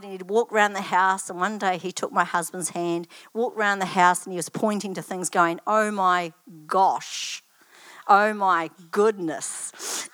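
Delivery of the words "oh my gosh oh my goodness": put-on slow, drawn-out child's exclamations; deliberately slow, imitated wonder; imitated grandson. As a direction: stretch each word out, mimicking a careful small boy. Imitates grandson pointing at things.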